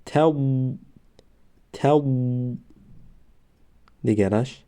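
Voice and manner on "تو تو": تو read clearly twice; syllable demo. Shown as a demonstration. Teacher reading تو aloud. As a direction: model تو for the class.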